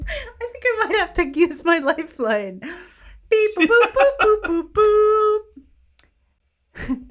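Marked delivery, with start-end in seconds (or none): 3.3-7.0 phone dial beeps. Pretend. Mimicking a phone call to Sam.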